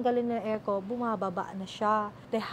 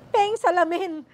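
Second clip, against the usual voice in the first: louder, higher insistent demand for the mirror; insistent repeat; 6 semitones higher, 8 dB louder, slower than usual. Retold or imitated. Patient insisting to her daughter, louder, on the mirror. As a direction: press harder the second time. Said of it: "Sulat ang sulat".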